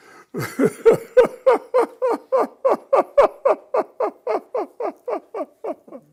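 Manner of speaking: long, high, roaring laugh, fading out; loud laughter. Real, not acted. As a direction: burst out laughing at the punchline. Big laugh at the punchline.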